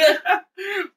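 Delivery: a real giggle at her own tease; high laugh. Real, not acted. Laughs after teasing husband.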